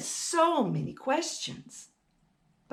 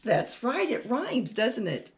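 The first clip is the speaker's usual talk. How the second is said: ordinary delivery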